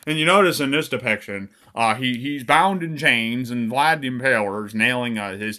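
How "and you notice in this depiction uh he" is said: usual speaking voice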